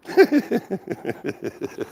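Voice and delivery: high-pitched burst of laughter. Real, not acted. Streamer laughing at the prank call. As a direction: laugh at the caller's line.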